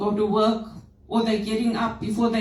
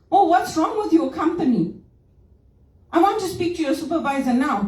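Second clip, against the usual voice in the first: higher-pitched, indignant customer complaint; irate caller; 6 semitones higher, faster than usual. Retold or imitated. Caller escalating to a supervisor, irate. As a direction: imitate a customer demanding action. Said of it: "That's how they talk to you".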